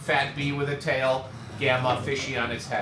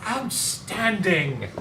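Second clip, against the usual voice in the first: drawn-out, higher-pitched praise; grand approval; 5 semitones higher, much slower than usual. Real, not acted. Teacher applauds the British accent attempt.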